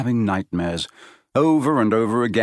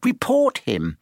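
put-on very high, firm girl's command; a hint of bossy Hermione; insistent; 14 semitones higher, slower than usual. A narrator's reading.

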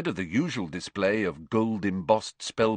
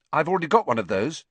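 fast, higher, hasty explanation; quick; 6 semitones higher, 5 dB louder, much faster than usual. A narrator's reading. Harry tells the manager he has the book, quickly. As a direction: get it out quickly. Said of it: "said Harry quickly".

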